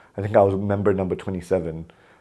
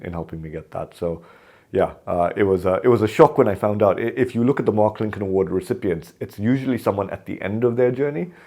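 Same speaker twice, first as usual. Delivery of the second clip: usual speaking voice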